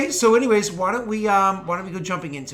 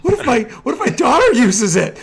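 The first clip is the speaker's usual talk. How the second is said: laughing; 6 semitones higher, 10 dB louder than usual. Real, not acted. Laughing disbelief.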